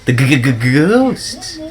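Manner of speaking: mock scared stutter. Spooked by a ghost in game.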